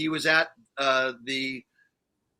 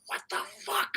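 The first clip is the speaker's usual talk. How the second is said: squeaky, much higher mock disbelief; stunned disbelief; 10 dB quieter than usual. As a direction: retell the shock like your voice jumped. Retold stunned reaction to [name].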